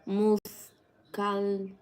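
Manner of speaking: "Muscaldeme" very slow, in separate syllables; unsure sounding-out. Real, not acted. Streamer trying an unfamiliar grape name aloud, halting and unsure. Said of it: "How do you say that?".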